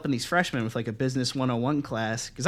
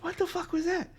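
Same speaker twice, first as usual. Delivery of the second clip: very high, softer stunned question; bewildered; 5 dB quieter than usual. Retold or imitated. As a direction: squeak it in hushed disbelief. Retells his bewilderment at the sight.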